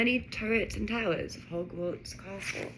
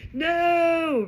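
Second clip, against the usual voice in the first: long, high, loud cry; yell; 11 semitones higher, 8 dB louder than usual. A narrator's reading. Ron sees the castle wall coming, yelling. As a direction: yell it out in alarm. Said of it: "Ron yelled".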